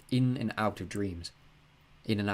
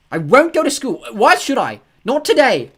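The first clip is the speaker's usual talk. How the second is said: furious shout, much higher and louder; angry shouting; 15 dB louder, slower than usual. A narrator's reading. Boy refusing to go to school, shouting. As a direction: shout your refusal at your dad.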